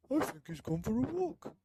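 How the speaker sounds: high, put-on old man. Old man answering his wife, high old voice. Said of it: "said the very old man".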